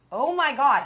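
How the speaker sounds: loud, high retold dismay; shocked exclamation. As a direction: cry out in storytelling shock. Reliving being found by husband.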